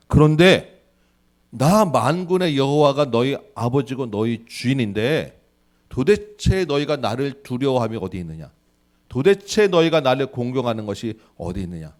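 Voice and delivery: loud, slow, booming reproach; God's indignant rebuke. Retold or imitated. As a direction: thunder it like an offended father demanding respect. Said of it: "지금 그 얘기를 하시는 거예요".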